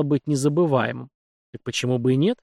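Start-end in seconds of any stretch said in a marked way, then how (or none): none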